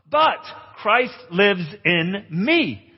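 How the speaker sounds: very slow, each word weighted; slow emphatic verse. Acted. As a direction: preach the verse deliberately. Preaching key verse.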